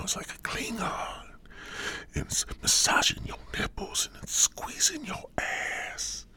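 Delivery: hushed, breath-only creepy tease; soft, voiceless mock seduction; mock-sensual whisper. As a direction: whisper it suggestively for laughs. Mock-sensual description of the high.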